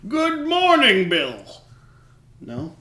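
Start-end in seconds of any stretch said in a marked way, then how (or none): 0.1-1.3 cheery greeting. Retold or imitated. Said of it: "Roosevelt was ever like".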